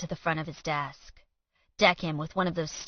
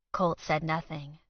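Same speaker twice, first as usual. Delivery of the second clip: ordinary delivery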